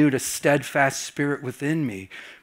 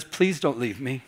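tender plea; 4 semitones lower, 7 dB quieter than usual. Retold or imitated. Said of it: "and she says".

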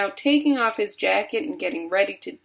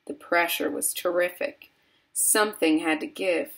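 plain reading voice

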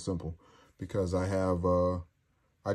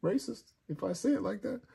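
mock question; 13 semitones higher, 3 dB quieter than usual. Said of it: "I was gonna go".

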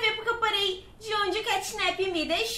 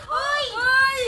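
loud high call; shout; 7 semitones higher, 5 dB louder than usual. Real, not acted. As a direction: yell it to get attention. Calling out to Catnap.

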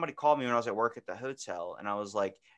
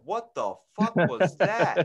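slow, loud startled outburst; mock shock at a ringing landline; 7 semitones higher, 7 dB louder, slower than usual. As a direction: jump at the sound. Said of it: "I was like".

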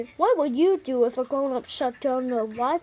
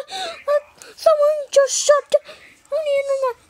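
shocked dismay; 12 semitones higher, 5 dB louder than usual. Acted. Kid dismayed internet cut.

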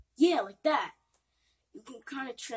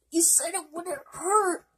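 high, tearful complaint; crying in pain; 8 semitones higher than usual. Acted. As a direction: whimper it through pain. In pain after drinking.

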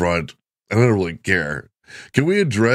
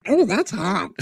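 put-on much higher girl voice, dry; high girl voiceover; 12 semitones higher than usual. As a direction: play the girl, pitched way up. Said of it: "has the voiceover actors".

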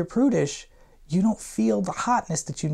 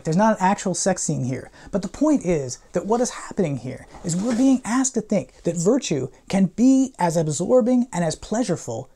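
normal talking voice

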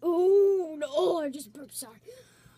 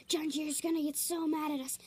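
ordinary talk

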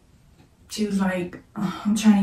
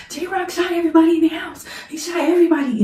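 retold panicked sister, much higher and louder; high, urgent repeat of the news; frantic sister; 10 semitones higher, 5 dB louder than usual. Sister insisting on the news over the phone, frantic and high. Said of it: "She was like".

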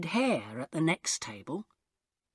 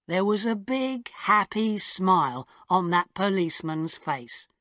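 ordinary delivery

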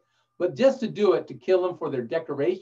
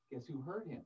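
usual speaking voice

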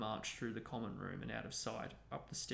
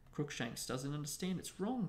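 ordinary delivery

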